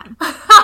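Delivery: burst of laughter. Real, not acted. Laughing at her own story.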